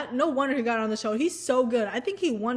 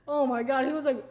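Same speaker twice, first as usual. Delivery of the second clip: usual speaking voice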